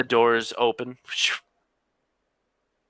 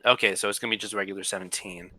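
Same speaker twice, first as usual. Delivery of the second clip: ordinary talk, nothing marked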